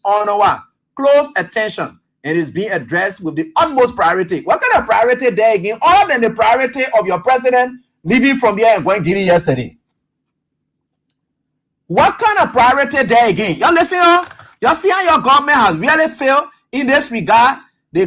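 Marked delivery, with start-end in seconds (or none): 4.4-13.6 louder, sarcastic question; scornful challenge. Real, not acted. 13.6-17.6 higher, louder call to the audience; rallying call. Real, not acted. Rallying viewers against government.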